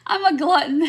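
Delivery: a laughing confession. Real, not acted. Host admits her odd choice to the chat, laughing.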